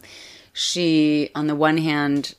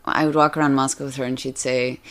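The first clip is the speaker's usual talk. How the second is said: ordinary talk, nothing marked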